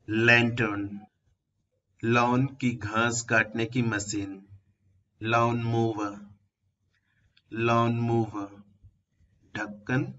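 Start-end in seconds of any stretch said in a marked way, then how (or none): none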